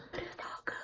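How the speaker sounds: breathy whispered line. Pretend. Quoting a movie line to herself in a whisper. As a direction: whisper it to yourself.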